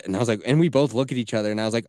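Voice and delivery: usual speaking voice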